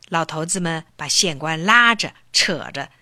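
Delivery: plain reading voice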